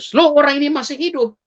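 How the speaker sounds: high-pitched mock astonishment; startled bystander. Bystander finding the supposed corpse alive, acted surprise. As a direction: re-enact a sudden startled discovery.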